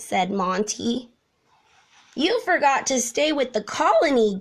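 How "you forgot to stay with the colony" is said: gasped. A narrator's reading. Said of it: "gasped the termites".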